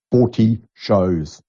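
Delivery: "Forty shows" drawn out very slowly, weight on "Forty"; slow emphasis. Real, not acted. Marvelling to listeners about forty shows, said very slowly.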